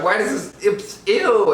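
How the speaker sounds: mock disgust. Child tasting mom's Chardonnay, mock disgusted. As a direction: recoil from a nasty taste.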